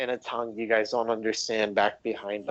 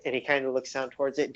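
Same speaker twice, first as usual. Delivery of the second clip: usual speaking voice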